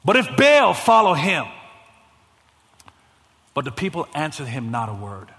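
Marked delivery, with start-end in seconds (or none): 0.0-1.4 loud, high prophet's challenge; full-voiced call to choose; preaching shout. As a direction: thunder it at the crowd. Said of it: "And he says".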